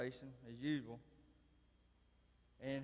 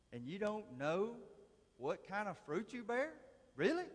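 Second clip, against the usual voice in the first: rising, louder disbelief ending on a high "Really?"; incredulous; 7 semitones higher, 4 dB louder than usual. Retold or imitated. Preacher retelling challenging a coworker, rising in disbelief. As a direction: push the challenge up into a stunned "Really?".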